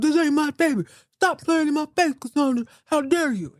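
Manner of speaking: high, put-on talk-show guest denial; Maury show guest. Richard denying the baby on Maury, mock talk-show voice. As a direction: ham up the not-the-daddy denial.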